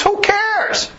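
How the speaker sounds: high scoffing brush-off; a high dismissive snort of a question. Real, not acted. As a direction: toss it away with a scoff. Dismissive outburst.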